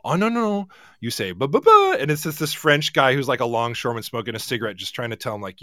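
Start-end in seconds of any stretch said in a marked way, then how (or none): 0.0-0.7 French teacher. Retold or imitated. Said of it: "he was the French teacher". 1.3-1.9 high, loud "ba, ba, ba" drill; French teacher impression. Visiting teacher in a sketch correcting the French, high and loud. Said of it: "he was the French teacher".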